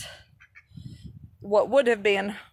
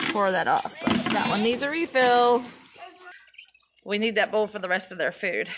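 ordinary delivery

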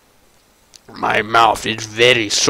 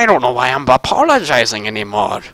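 ordinary talk, nothing marked

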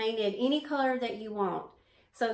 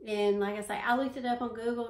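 usual speaking voice

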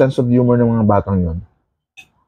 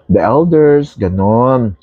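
talking with food in mouth; speaking between bites; chewing while talking; 2 semitones lower, slower than usual. Real, not acted. Seen 1: Eats with spoon throughout; lips closed, jaw working between words.

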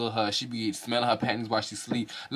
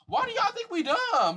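high, slow, disbelieving; incredulous; slower than usual. Real, not acted. Asking viewers if they think we are dumb, high and slow. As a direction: stretch it out in disbelief. Repeated rhetorical question.

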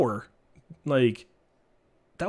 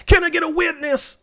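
excited call out; 13 semitones higher, 5 dB louder than usual. Real, not acted. Hyped call to chat.

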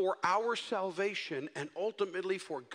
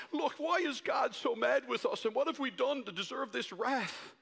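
higher, whining mock lament; imitating his own tearful panic to his wife; mock crying; 6 semitones higher than usual. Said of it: "I call Debbie and I'm crying".

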